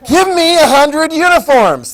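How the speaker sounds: loud, high, slow play-acted command; bullying mock demand. British government seizing from the store, loud mock demand.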